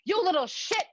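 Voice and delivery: high, loud scolding; furious outburst. Acted. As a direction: explode at a cheeky child. Mother snaps at her son.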